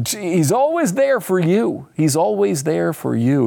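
slow, drawn-out salesman pitch; lightly mocking, slow smiling sales voice; salesman-y reassurance. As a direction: reassure like a salesman, stretching the words. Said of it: "a little more salesman-y".

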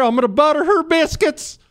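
high hammed-up Southern rooster drawl; mock a folksy Louisiana one-liner; Foghorn Leghorn drawl. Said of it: "drops some foghorn leghorn lines".

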